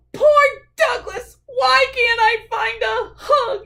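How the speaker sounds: high, loud sobbing whine; tearful whine. Acted. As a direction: sob about having no hug. Sad bear laments no hug.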